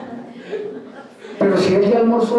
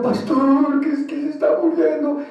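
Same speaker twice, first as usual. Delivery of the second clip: imitación de llamada llorosa, más aguda; suplícale al pastor fingiendo que lloras; llanto imitado; 4 semitones higher than usual. Acted. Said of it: "Si usted me llama a mí llorando". (lloriqueo fingido y agudo)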